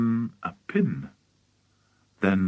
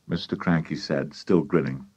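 ordinary delivery

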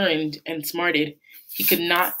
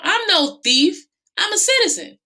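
indignant protest; 14 semitones higher, 4 dB louder than usual. Acted. Bate protests arrest.

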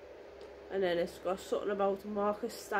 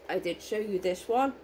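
ordinary talk

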